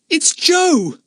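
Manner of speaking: put-on very high-pitched boy's exclamation; startled cry. A narrator's reading. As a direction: cry out in astonished recognition. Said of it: "cried Jack".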